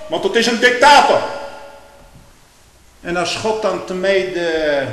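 loud, high, slowing pulpit tirade; preaching at full force. Acted. Preacher railing against the revolutionary newspaper, full force. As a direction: hammer each word from the pulpit.

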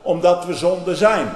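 loud, high thundering about wrath; fiery preaching. Real, not acted. Preacher thunders to the congregation about God's wrath. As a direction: thunder at the sinners.

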